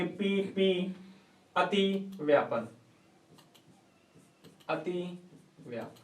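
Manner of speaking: drawn-out term, spaced for writing; slow dictation. Real, not acted. Teacher gives the class the term अतिव्यापन, slow dictation. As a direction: dictate it slowly, letting the voice fall.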